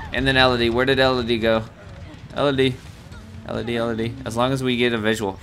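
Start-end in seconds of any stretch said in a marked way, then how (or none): none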